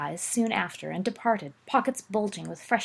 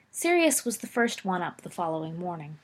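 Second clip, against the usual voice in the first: ordinary delivery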